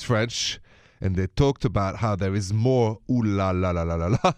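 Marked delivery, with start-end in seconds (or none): 3.1-4.3 laughing ooh la la. Real, not acted.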